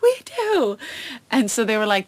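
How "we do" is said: much higher, excited shout of we do; eager answer. Retold or imitated. Answering the audience warm-up, eager and excited. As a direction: jump in eagerly. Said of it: "And I'm like".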